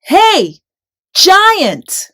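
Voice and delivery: high loud shout across the field; yell. A narrator's reading. David yells a challenge at Goliath. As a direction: call out to the giant with full force. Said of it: "David yelled".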